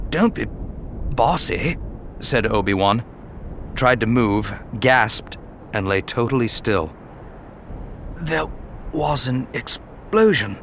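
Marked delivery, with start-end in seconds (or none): 0.1-1.8 higher, strained voice of a dying man; weak, wounded Obi-Wan. Acted. Dying Obi-Wan teases Anakin, weak and strained. 8.2-10.6 slow, faint words of an injured man; weak, wounded Obi-Wan. Acted. Injured Obi-Wan tells Anakin, faint and slow.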